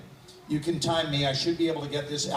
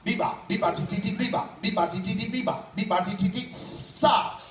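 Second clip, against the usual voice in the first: higher, crisp articulation drills; vocal warm-up drills; 5 semitones higher than usual. Shown as a demonstration. Vocal warm-up in front of the audience. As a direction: snap through each syllable drill. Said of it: "vocal warm-up".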